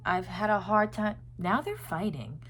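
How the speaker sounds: normal talking voice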